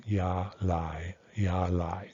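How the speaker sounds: "ya, lai" held level on both syllables; level tones. Shown as a demonstration. Teacher demonstrates the last two syllables, "ya, lai" in level tones. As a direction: keep the pitch even through "ya" and "lai". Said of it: "these two are level tones".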